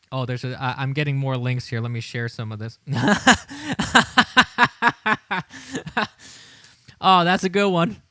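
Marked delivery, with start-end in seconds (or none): 2.8-6.1 rising chuckle; laugh. Real, not acted. Streamer laughing at a new link. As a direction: chuckle along.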